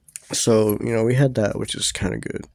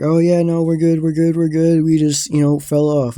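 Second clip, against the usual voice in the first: imitated high, loud insistence; hasty reassurance; 5 semitones higher, 6 dB louder than usual. Teller covering up to friend's dad, hasty reassurance. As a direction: act out nervously covering up, repeating yourself.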